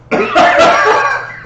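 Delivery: high loud burst of laughter; helpless laughter; laughing hard. Real, not acted. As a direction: crack up at the memory. Recalling the botched joke.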